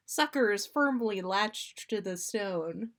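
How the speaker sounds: ordinary delivery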